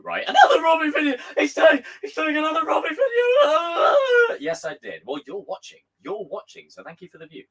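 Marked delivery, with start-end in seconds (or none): 0.3-4.4 high, loud mock wailing complaint; hammed-up hater crying out in dismay; mock whiny hater. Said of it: "My intention with a Robbie video".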